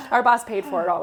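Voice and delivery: laughing. Real, not acted. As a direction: say "Our boss paid for it all" while laughing out loud.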